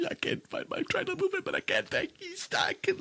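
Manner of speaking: high, whispery, pitiful pleading; hammed-up helpless plea to the officer; pitiful fake plea. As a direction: beg softly like you're helpless. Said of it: "I'm like".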